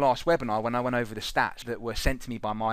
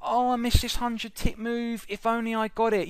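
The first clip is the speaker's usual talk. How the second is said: mocking a trader moaning about a missed move; whining trader; 10 semitones higher than usual.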